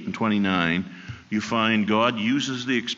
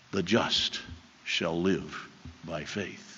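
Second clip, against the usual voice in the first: soft solemn verse; 5 semitones lower, 7 dB quieter, slower than usual. Acted. Repeated key verse.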